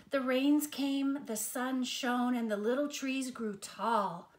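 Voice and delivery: usual speaking voice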